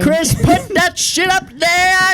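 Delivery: high, drawn-out announcer proclamation; triumphant announcer. Pretend. Host declaring Clue the winner, triumphant announcer. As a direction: proclaim the result slowly, pitched high.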